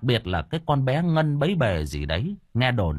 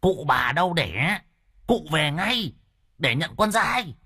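pitched-up insistent call; high, urging delivery; calling to the deaf; 6 semitones higher than usual. Acted. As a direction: make a deaf elder understand. Shouting to a deaf old man.

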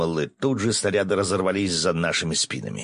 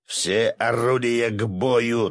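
drawn-out, higher-pitched battle order; command; 5 semitones higher, much slower than usual. A narrator's reading. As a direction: give the gun crews an order. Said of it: "тут же скомандовал Виктор Харитонович". (протяжная боевая команда)

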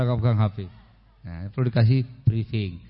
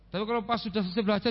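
usual speaking voice

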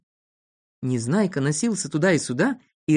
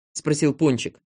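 usual speaking voice

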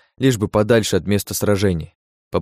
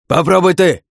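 shouting; 10 semitones higher, 6 dB louder than usual. A narrator's reading. Swordsman shouting mid-fight.

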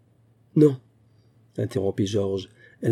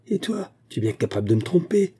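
angry cry; 8 semitones higher than usual. A narrator's reading. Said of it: "cria-t-elle".

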